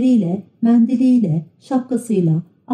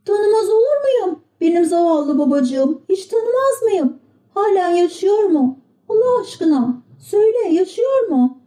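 put-on high, anxious young puppet; pleading puppet boy; 12 semitones higher than usual. A narrator's reading. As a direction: beg to know his father lives. Pinokyo pleads about his father.

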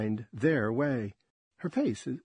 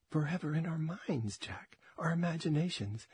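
whispering; 4 semitones higher, 6 dB quieter than usual. Acted. Lovers' intimate moment.

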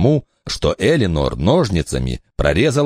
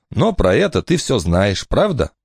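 higher, lively woman's voice; mother's voice; 5 semitones higher than usual. Acted. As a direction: speak up bright as the mother. Eleanor asks.

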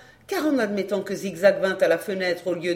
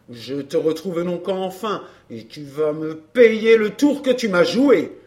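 angry man's threat, building louder; carried away by anger; 3 semitones lower, 5 dB louder than usual. A narrator's reading. Courrapier promising Amanda revenge, angry. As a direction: start contained, swell into a loud threat. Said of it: "emporté par la colère".

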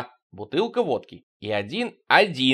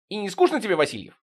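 sudden blurt; 8 semitones higher, faster than usual. Acted. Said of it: "Потапов внезапно".

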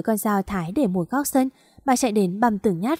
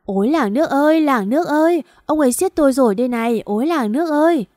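high, loud theatrical wail; woman throwing a loud public tantrum, wailing; wailing for the village; 5 semitones higher, 3 dB louder than usual. Acted. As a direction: lie there and wail for the whole village to hear. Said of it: "Bà An nằm tại chỗ ăn vạ".

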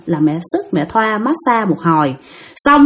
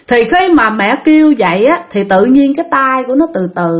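usual speaking voice

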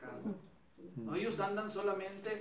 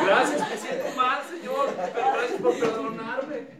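loud laughing thanks; mock sheepish prayer, laughing loudly; 5 semitones higher, 12 dB louder than usual.

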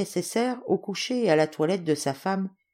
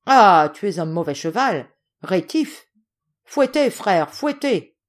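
bullying shout; 3 semitones higher, 7 dB louder, slower than usual. Acted. Yegor to his brother about the stubborn horse, shouting. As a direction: scold the horse, then cry out for the whip. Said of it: "lui criait Yegor".

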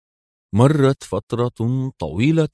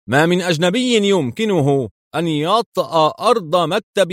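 high-pitched indignant insistence; angry; 8 semitones higher than usual. A narrator's reading. General insists nobody can get in, angry. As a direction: pitch it high in heated denial. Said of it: "وقال في غضب".

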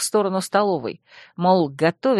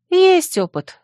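high-pitched wry remark; lightly smug, pitched up; wry humph; 12 semitones higher, 4 dB louder, slower than usual. A narrator's reading. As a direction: toss it off with a humph. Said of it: "хмыкнула я".

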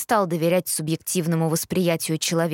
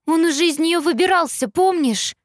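anxious; 9 semitones higher, 3 dB louder than usual. A narrator's reading. Panicked daughter to mother.

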